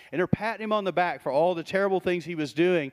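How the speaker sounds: ordinary talk, nothing marked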